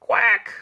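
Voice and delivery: high little duck quack; a quick alarmed quack; startled quack. Retold or imitated. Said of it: "went Missus Mallard".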